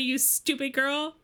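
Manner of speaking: put-on sneering, high mean-girl voice; wicked stepmother.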